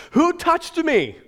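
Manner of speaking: high, loud, slow question to the crowd; calling out. Retold or imitated. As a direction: call out over the crowd. Jesus asks in the crowd.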